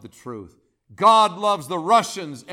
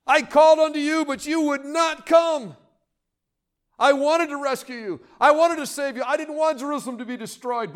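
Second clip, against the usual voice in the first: weeping plea; 6 semitones higher than usual. Acted. Jesus weeping over Jerusalem, lamenting cry. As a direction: plead as if heart is breaking, then fade. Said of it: "Jesus is weeping".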